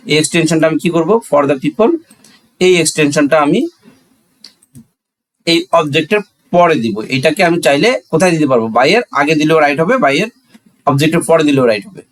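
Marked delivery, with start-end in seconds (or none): none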